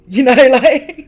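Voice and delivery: bursting into laughter mid-phrase; words swallowed by a big laugh. Real, not acted.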